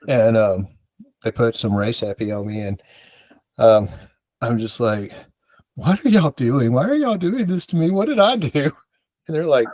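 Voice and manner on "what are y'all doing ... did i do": high, rising, pleading voice; a high, whining plea; whiny protest. Retold or imitated. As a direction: complain like a wronged kid. Retold self panicking.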